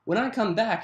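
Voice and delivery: plain reading voice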